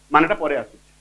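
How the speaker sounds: ordinary talk, nothing marked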